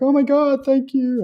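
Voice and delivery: high, loud, overjoyed thanks; imitated tearful gratitude. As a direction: imitate someone overcome with gratitude. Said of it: "they're, like, crying and, and really enjoy it".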